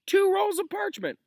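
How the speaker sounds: very high, loud squeal; squealing outraged student. Acted. Student protests Snape's homework to Lupin, squealing. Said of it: "explosion of complaints".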